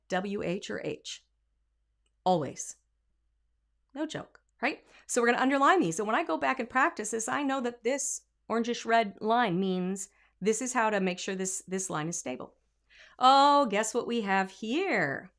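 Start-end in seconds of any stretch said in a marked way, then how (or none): none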